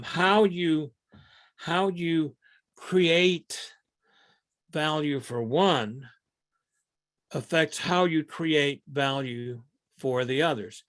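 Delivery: slow, every word spaced and stressed, "how" and "create" drawn out; slow and deliberate. Shown as a demonstration. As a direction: lay out "how you create value for one" slowly, word by word. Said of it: "these interests are intertwined".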